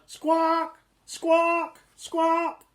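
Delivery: high, loud squawking hen. A narrator's reading.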